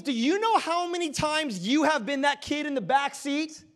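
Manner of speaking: rising pulpit fervor; preaching. Acted. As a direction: press the point home to the congregation. Preacher builds to urgent appeal.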